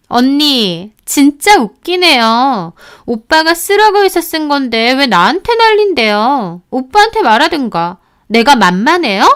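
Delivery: sister-in-law yelling at the wife on the phone; shrill yelling. Retold or imitated. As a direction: shriek back at her in outrage. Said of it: "소리를 빽빽 지르는 겁니다".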